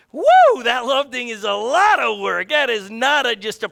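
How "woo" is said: sudden high whoop; a real high whoop of relief; whooping woo. Real, not acted. Exclaims at love's hard work.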